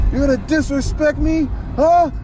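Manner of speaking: retold drunken challenge, rising on the huh; indignant challenge. Acted quarrel, drunk man challenging him.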